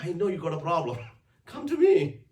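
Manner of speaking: a laughing, lilting invitation pitched high; high playful Jesus voice. Retold or imitated. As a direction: call them in like a gentle friend who's amused. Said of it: "He's saying".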